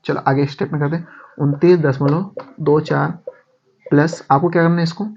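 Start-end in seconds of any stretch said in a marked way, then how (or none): 1.4-4.2 slow, each digit separate; slow dictation. Shown as a demonstration. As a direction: read it out slowly for copying. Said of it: "dictating a sum step by step".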